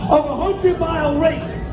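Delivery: high, sustained rallying delivery; triumphant climax. Real, not acted. Speaker brings the hundred-mile race home to the audience, triumphant. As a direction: build to the finish line, ringing out each phrase.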